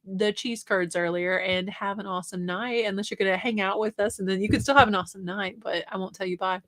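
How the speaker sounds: ordinary talk, nothing marked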